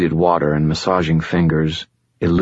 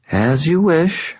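higher, silky voice of an ancient fallen angel, calm and superior; fallen angel Lashiel; 12 semitones higher, slower than usual. Acted. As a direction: speak as a patient otherworldly being, a little above it all. Lashiel speaks.

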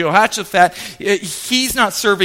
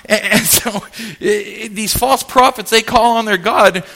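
normal talking voice

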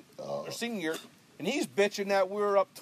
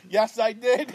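cocky teammate; 4 semitones higher, 6 dB louder, slower than usual. Retold or imitated. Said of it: "Mike Freeman goes".

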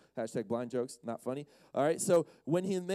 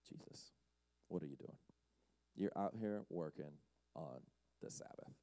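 very soft, low scandalized scolding; hushed disapproval; 17 dB quieter than usual. Acted. Pharisees scolding Jesus for Sabbath work, hushed. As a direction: barely voice it, as if appalled.

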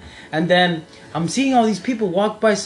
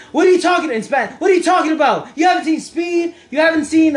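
imitating mom yelling in disbelief, higher and louder; mom shouting; 10 semitones higher, 4 dB louder than usual. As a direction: shout it like an outraged mother who can't believe it. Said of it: "my mom's like".

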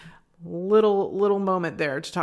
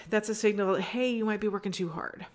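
ordinary talk

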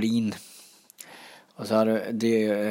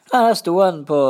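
excited; 9 semitones higher, 7 dB louder than usual. Real, not acted. Finding lost duck Dragon.

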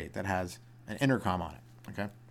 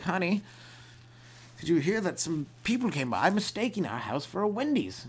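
high mock-aghast voice; hammed-up wealthy indignation; dismayed rich husband; 9 semitones higher than usual. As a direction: call down a long dinner table, pitched up. Acted rich husband calling across long table.

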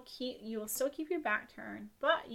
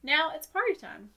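announcing; 6 semitones higher, 9 dB louder than usual. Acted. Reading the ritual instructions.

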